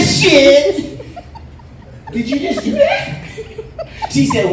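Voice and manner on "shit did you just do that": shocked disbelief. Retold or imitated. Comic reacting to his date popping her leg off, shocked laugh. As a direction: yelp it out in disbelief.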